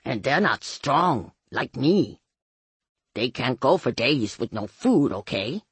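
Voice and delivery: put-on man's voice, dropping at the end; Ray. A narrator's reading. Ray defends his hungry boys, male voice. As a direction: plead their case in a man's voice.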